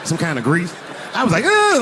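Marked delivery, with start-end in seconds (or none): 1.4-1.8 a sharp high grossed-out "ugh"; disgusted groan. Retold or imitated. Grabbing the attacker's greasy dreads, squealing in disgust. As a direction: recoil with a loud squeal of disgust. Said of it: "like this".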